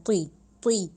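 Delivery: طی with a thick ط and a short i; heavy ti. Shown as a demonstration. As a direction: say طی heavy, full-mouthed. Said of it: "تو زیر طی".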